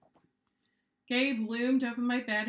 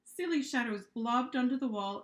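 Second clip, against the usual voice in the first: ordinary delivery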